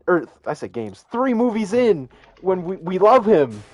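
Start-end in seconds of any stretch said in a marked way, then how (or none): 1.1-3.6 mad Angry Joe. Retold or imitated. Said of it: "he was mad".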